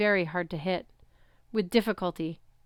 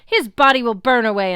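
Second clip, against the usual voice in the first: loud booming titan voice, pitched up; furious titan roaring; outraged roar; 4 semitones higher, 10 dB louder than usual. A narrator's reading. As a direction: roar it in fury. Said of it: "Kronos roared in outrage".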